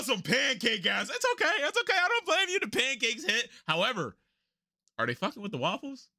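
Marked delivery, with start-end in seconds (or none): 1.1-3.5 very high, sing-song reassurance; mock consoling. Real, not acted. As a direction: pat them on the head. Mock consoling pancake fans.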